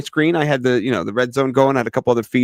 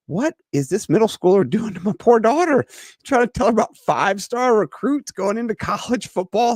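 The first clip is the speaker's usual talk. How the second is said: mock outrage; 10 semitones higher than usual. Dad mock-outraged at daughter's friend.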